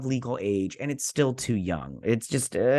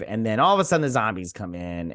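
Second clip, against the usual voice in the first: ordinary talk, nothing marked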